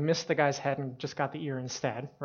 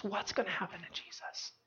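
soft, higher, furtive question; hushed worry; 4 semitones higher, 11 dB quieter than usual. Retold or imitated. Peter ducking behind bushes, hushed worry. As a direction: act out wondering anxiously from a hiding place. Peter hiding, anxiously watching.

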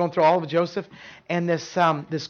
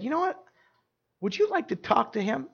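a hint of a higher, softer friendly voice; lightly voiced woman friend; 7 semitones higher, 5 dB quieter than usual. The dining hall manager offering to set up a talk, lightly voiced. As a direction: offer it gently, pitched up. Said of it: "and she said".

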